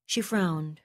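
plain reading voice

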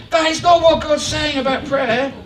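firm preacher's shout, not punched; preaching shout. Real, not acted. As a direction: drive the point home with a shout on not.

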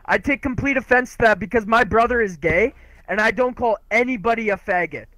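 usual speaking voice

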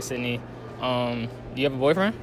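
ordinary talk, nothing marked

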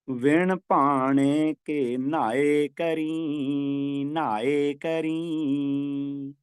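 very slow chanted recitation with a brief pause after ਭਾਣੇ; slow scripture recitation, ਨਾਏ ਕਰੀ set apart. Shown as a demonstration. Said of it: "ਭਾਣੈ ਤੇ ਥੋੜ੍ਹਾ ਠਹਿਰਾ ਦੇ ਕੇ".

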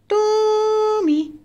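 high, very drawn-out first syllable; lilting two-note calling; singsong name call. Real, not acted. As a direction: call a pet with a long held note.

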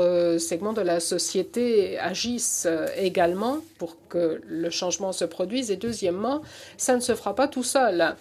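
American accent in French; French spoken with an American accent; foreign accent in french. Real, not acted. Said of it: "mon français est vraiment, euh, rudimentaire".

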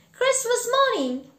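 put-on high, loud boyish reply; excited boy. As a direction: call back brightly as a young boy. Boy answers Scrooge from the street.

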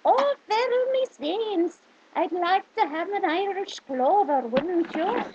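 high, loud mock Irish accent; put-on Irish accent. Shown as a demonstration. Showing off accents with an Irish clover line, put-on Irish. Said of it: "a couple of my accents".

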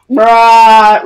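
loud, held-out mock protest; drawn-out bruh. Kid answering pizza guy's tip demand, drawn-out bruh. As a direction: stretch one word out loudly on a level pitch. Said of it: "And then I'm like".

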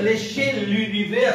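usual speaking voice

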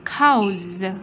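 COWS ending in a buzzed Z; final Z sound. Shown as a demonstration. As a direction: finish "Cows" on a clear Z. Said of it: "Z ending sound".

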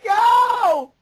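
high, loud call to go; shout. Real, not acted. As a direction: bark the cue out. Urging a co-actor.

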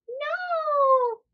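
high whiny no; sleepy girl protest. Acted. Farnaz refusing to wake up, high whiny girl voice. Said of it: "Farnaz says".

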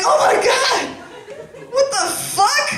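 startled man cries out in disbelief; freaked-out guy. Retold or imitated. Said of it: "That guy would be like".

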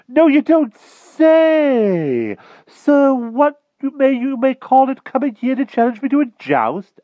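very high, loud, stretched exclamation; astonished King Pellinore. A narrator's reading. King hears of a challenge, astonished. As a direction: cry out in blustering amazement, way up high.

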